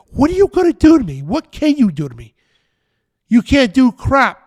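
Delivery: high, loud taunting challenge; hammed-up taunt at the enemy, slowing on the last words; defiant shout. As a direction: throw it in their faces, fearless. Mock challenge to enemies, defiant.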